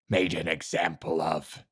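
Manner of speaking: slow, low, gravelly master. A narrator's reading. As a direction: growl it out slowly like a shadowy master. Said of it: "spoke in his low, gravelly voice".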